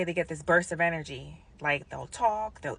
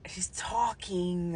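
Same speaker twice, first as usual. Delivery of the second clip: awed whisper; 7 dB quieter, much slower than usual. Retold or imitated. Telling nurses in disbelief.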